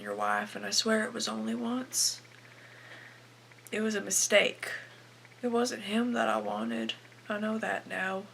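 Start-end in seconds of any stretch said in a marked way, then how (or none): none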